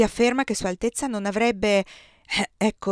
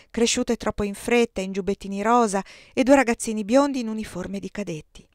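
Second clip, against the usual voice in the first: ordinary delivery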